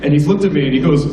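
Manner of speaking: ordinary delivery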